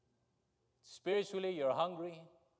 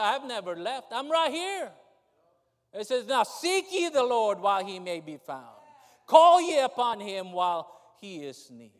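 preaching scripture; 5 semitones higher, 11 dB louder than usual. Acted.

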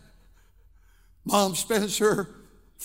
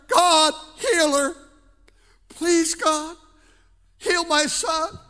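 raised, drawn-out pleas to God; pleading prayer; 5 semitones higher, 4 dB louder, slower than usual. Acted. Parent praying for an addicted daughter, pleading. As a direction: beg heaven in separate, halting bursts.

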